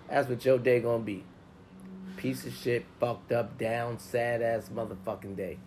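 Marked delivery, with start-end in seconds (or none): none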